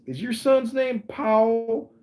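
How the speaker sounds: slow, high-pitched mock-official question; first sergeant questioning. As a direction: imitate a sergeant addressing a worried mother. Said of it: "And the first sergeant says".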